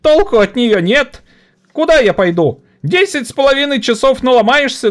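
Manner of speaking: put-on high, bitter boyish complaint; mock the library; exhausted young lad. (измученный паренёк)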